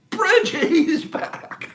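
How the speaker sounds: high slow drawn-out welcome; excited welcome. Real, not acted. Host welcoming back a viewer, slow excited call. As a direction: stretch it out, delighted. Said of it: "I love saying his name".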